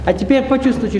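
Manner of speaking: normal talking voice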